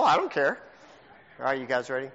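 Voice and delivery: ordinary delivery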